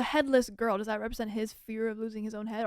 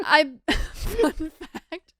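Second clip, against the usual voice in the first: laughing; 6 semitones higher, 7 dB louder, much slower than usual. Real, not acted. Mocking "fun fact" aside.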